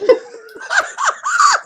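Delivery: high, loud burst of laughter; real screaming laugh; shrieking laugh. Real, not acted. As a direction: crack up helplessly. Host cracks up.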